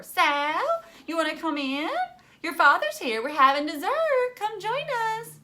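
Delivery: high, loud and sugary calling out; crackly neighbour voice. A narrator's reading. Margaret calling Sal in from next door, high and crackly. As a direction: call out sweetly over the fence. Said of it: "the dead leaf crackly voice of Margaret Cadavers".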